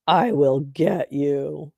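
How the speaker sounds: slow, low villainous threat; mock menace. Streamer mocking a heckler's comment, slow mock menace. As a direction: draw out the threat ominously.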